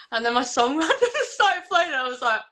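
high, laugh-broken storytelling; laughing while talking. Real, not acted. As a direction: laugh your way through the surprise. Laughs while telling the surprise.

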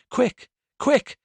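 high, loud, frantic urging; urgent cry. A narrator's reading. Frantic sister sends neighbour for the doctor, urgent cry. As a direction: build towards a shriek. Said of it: "The voice ended in a shriek".

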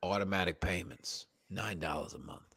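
low, soft salesman murmur; imitating the salesman. Salesman at the store pushing payments, low and soft. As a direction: slide the deal over quietly like a salesman. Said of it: "He's like".

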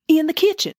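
gasping, high and urgent; a sharp breath, then reveal; dramatic gasp. Acted.

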